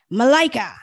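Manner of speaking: loud, high angry call of her name; growled name. A narrator's reading. Husband calls his wife back, angry loud call. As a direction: bark her name to make her jump. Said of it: "Axel growled".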